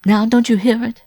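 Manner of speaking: high, loud alarmed woman; gasping cry. A narrator's reading. Wife hearing the dog approach, terrified gasp. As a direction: cry out in rising panic. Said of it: "cried with an inarticulate gasp".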